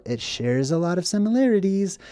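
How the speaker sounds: normal talking voice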